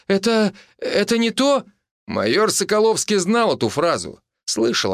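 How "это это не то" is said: high, stammered protest; panicked excuse. A narrator's reading. Wife tries explaining to husband, panicked. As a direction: stumble into the excuse, pitch way up. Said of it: "вскрикнула она, вскочив".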